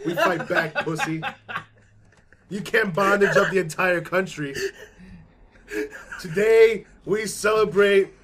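loud, slow rousing presidential speech; rally the troops, half laughing; Bill Pullman. Retold or imitated. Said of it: "Bill Pullman".

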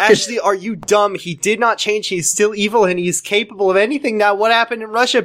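high, exasperated telling-off; mock scolding. Narrator telling her sister he's still evil, high mock scolding.

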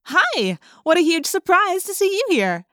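bright, overdone surprised greeting; fake surprise. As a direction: act amazed to bump into her. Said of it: "pretending to be surprised to see each other".

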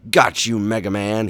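loud cartoon villain gloat. A narrator's reading. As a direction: crow over the beaten hero. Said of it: "Bubble Man gloated".

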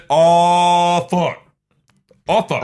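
loud, drawn-out horrified reaction; shocked outburst. Retold or imitated. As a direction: let the dread burst out slowly. Acting out shock at the scare.

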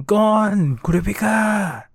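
high-pitched mock yell, drawn out slowly; retold yelling. Leorio warning his friends from the cave, slow high yell. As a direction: call out the names like he's trapped in the cave. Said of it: "'cause it was Leorio yelling".

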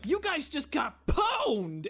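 high, loud, drawn-out taunting shout; mock gloating yell. Protagonist taunting foes on the battlefield, mock triumphant yell. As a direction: yell it hammed-up, stretching the words. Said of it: "standing in the battlefield just yelling".

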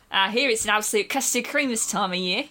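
cheeky Cockney gentleman; broad London Cockney; Cockney accent. Acted. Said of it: "I'm a Cockney gentleman".

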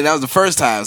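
usual speaking voice

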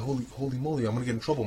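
ordinary talk

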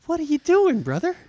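very high-pitched mock scolding; mock exasperation. Host needling co-host about unfinished work, mock exasperated. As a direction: demand an explanation way up high.

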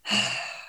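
long unvoiced exasperated sigh; audible sigh. Sighing at a coworker who keeps retrying. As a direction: let out a heavy, breathy sigh. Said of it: "And I went".

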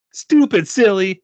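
put-on slow, high, loud child's taunt; high-pitched sassy kid; scolding little girl. A narrator's reading. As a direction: drag out the childish insult. Girl insults the adult.

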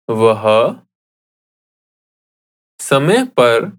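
broken into small chunks, long gaps between; slow repetition. Shown as a demonstration. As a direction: dictate slowly, leaving room to echo each word. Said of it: "I'll say it again slowly".

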